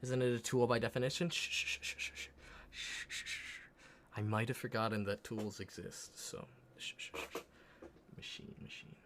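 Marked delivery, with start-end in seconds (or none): 1.3-3.6 shushing. Real, not acted. Shushing chat teasing him. 6.8-7.4 shushing. Real, not acted. Shushing chat teasing him.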